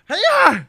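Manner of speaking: loud sudden sneeze; sneezing. Real, not acted. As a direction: sneeze out sharply, high and loud. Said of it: "J'ai, j'ai, j'ai éternué".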